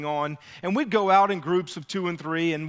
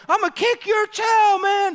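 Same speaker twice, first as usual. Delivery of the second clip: much higher, loud threatening yell; retold scream; 6 dB louder than usual. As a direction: scream it like the angry older brother across the levee. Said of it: "he's screaming to this little boy".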